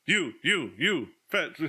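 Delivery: high, punchy mock calling; imitated summoning calls. Imitating the official grabbing townsfolk, mock calling out. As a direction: mimic someone picking people out, pitch jumping. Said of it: "He just, like, grabs and points".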